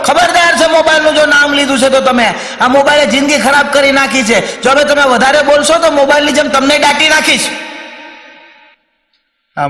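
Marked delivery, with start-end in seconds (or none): 0.0-7.5 high-pitched scolding wife; angry threat. Acted. Wife forbids husband to name the mobile, acted furious threat. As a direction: rant high and unbroken, threatening him.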